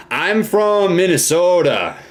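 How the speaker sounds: slow, drawn-out Minnesota vowels; Minnesota accent. Shown as a demonstration. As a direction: stretch it out in a put-on Minnesota accent. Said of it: "Can I hear your best Minnesota accent?".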